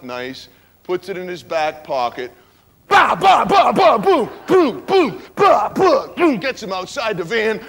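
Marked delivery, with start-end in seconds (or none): 2.9-6.4 loud, high mock punch noises; punch sounds. Frankie beating the driver, loud mock punch noises. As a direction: beat out the blows with your voice.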